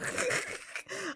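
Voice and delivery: soft, voiceless snickering; quiet wheezy snicker; stifled laugh. Real, not acted. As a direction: try to hold the laugh in. Recalls holding in the laughter.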